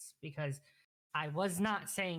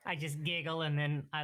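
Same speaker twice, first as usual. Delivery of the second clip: normal talking voice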